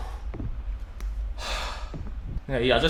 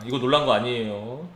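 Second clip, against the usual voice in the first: ordinary talk, nothing marked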